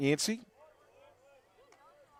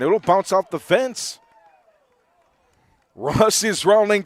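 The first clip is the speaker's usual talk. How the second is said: loud, excited play-by-play call, rising higher; home run call; 7 semitones higher, 12 dB louder than usual. Real, not acted. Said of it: "rev up the home run call".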